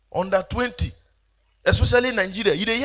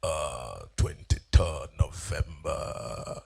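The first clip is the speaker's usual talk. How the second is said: very low and very slow; deep, slow, quiet show-off voice; deeper voice; 6 dB quieter, much slower than usual. Retold or imitated. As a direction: drop the voice even deeper to compete. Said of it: "ticking in voice times forty".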